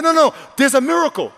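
high, loud retold protest; emphatic high-pitched contradiction; insistent objection. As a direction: cut in loudly, insisting over the other person. Retold argument with the doctor.

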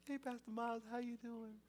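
put-on high, soft child voice; small, high-pitched kid greeting; little girl. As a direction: imitate a five-year-old on the phone. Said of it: "five years old".

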